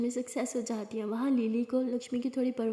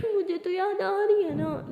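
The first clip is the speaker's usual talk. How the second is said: high, drawn-out weepy whine; tearful whine; 8 semitones higher, 3 dB louder than usual. Acted. Friend answers being told to stop crying, tearful whine. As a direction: plead through mock tears, stretching the words.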